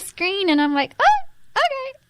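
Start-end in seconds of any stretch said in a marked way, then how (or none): 1.0-2.0 much higher, impressed oh okay; mock swoon. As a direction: swoon at the handsome actor. Swooning over Mark Ruffalo on screen.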